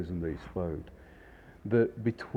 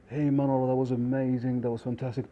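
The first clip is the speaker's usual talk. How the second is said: higher, louder imitation of delighted guests; enthusiastic praise; 5 semitones higher, 4 dB louder, faster than usual. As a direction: gush like a pleased diner. Guests praise the waiter in story.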